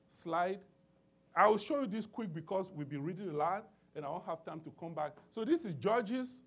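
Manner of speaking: ordinary talk, nothing marked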